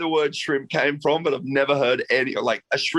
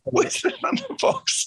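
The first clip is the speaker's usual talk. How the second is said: laughing, slow, much higher mocking repeat; mock tourist line. Guest laughing as he echoes the host's phrase. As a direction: echo his tourist line through laughter.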